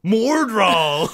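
high, very loud playful bellow; full-volume silly proclamation; mock-epic shout. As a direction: roar MORD RAWL like a hammed-up battle cry. Same invented name, escalated to shout.

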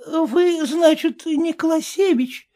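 put-on high, slow dismayed old dame; lightly voiced flustered old woman; dismayed old lady. A narrator's reading. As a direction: realize the mix-up with dread. Old lady realizing mistake.

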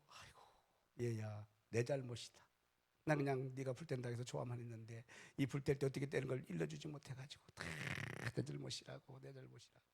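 soft, fading, self-blaming mother-in-law; tender apology. Retold or imitated. Mother-in-law softly comforting the crying bride, tender. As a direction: comfort her gently, taking the blame.

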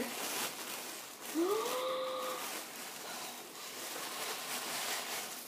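long breathy gasp of shock; drawn-out stunned intake of breath; astonished gasp. Real, not acted. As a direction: gasp long and high in amazement. Opens another surprise.

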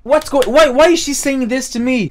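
loud, high-pitched bewildered questioning; baffled outburst. Retold or imitated. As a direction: reenact disbelief, pitch jumping up. Reacting to friend's baffling confession.

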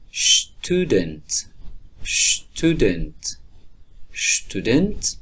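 شتودنت with sh-t onset, repeated, last rising; st said as sht. Shown as a demonstration. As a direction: model شتودنت.

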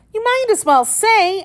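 tiny, very high creature voice; sleep-talking Dormouse. A narrator's reading. Dormouse joins the argument, high sleep-talking voice. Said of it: "seemed to be walking and talking in its sleep".

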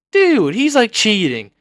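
high, exasperated complaint; indignant protest. Real, not acted. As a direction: cry foul at a cheating opponent. Protests at opponent cheating.

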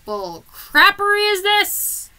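slow, loud, disbelieving; hammed-up outrage, high and loud; indignant outrage. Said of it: "I'm just sitting there going".

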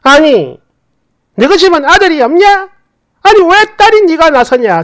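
hammed-up scolding, pitch shooting up; mother-in-law snapping at daughter-in-law on the phone; retold indignant outburst. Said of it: "첫 마디가 이거였어요".

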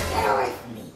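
low possessed growl; demon voice. Pretend. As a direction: hiss it out like something evil. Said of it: "That's the demon talking".

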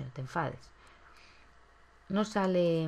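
normal talking voice

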